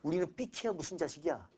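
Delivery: ordinary delivery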